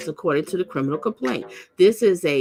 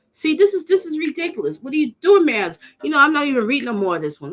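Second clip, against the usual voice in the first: much higher, louder fed-up cry; exasperated outrage; 8 semitones higher, 4 dB louder, faster than usual. Real, not acted. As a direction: throw up your hands at it. Outrage at release.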